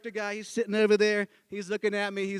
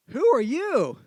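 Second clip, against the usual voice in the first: high, swooping stagey question; bench questioner; 6 semitones higher, 4 dB louder, slower than usual. Retold or imitated. Director modelling the bench person's line, stagey. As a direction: sing out the setup line, high.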